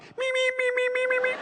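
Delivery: very high meeping squeak; Muppet lab assistant. Retold or imitated. Showing how lab assistants talk, high meeps. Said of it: "this is how lab assistants talk".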